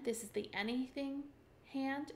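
ordinary talk, nothing marked

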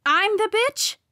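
high, loud, indignant comeback; incredulous angry yelp; snapped. A narrator's reading. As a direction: throw it back in outrage. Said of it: "I snapped".

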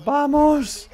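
high, loud cheer; explosive fan cry; triumphant yell. Real, not acted. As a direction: let out a big whooping shout. Cheering the save.

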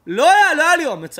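loud, high cry of confession; anguished cry. Acted. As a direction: shout the confession out. Confession shouted to God.

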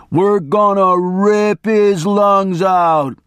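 slow, high hammed-up menace; snarled threat. A narrator's reading. Attorney's hammed-up threat to the hitchhiker. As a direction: growl the threat out slowly.

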